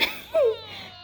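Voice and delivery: a sudden high squeal of laughter; real laugh that goes high and almost tearful. Real, not acted. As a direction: crack up high in mid-sentence. Laughing while recounting.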